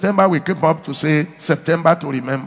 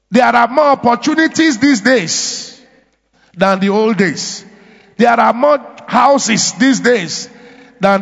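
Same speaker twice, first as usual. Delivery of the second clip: louder and higher, each "these days" punched; rousing preaching; 5 semitones higher, 3 dB louder than usual. Acted. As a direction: drive home that these are better days. Preacher listing, building to shouts.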